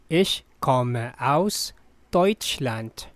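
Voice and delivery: very slow, word by word; drawn-out, careful teaching model; slow repeat. Shown as a demonstration. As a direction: pause between words for learners.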